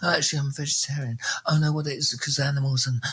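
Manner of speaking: a hint of a picky eater; fussy vegetarian. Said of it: "if you heard me on every show".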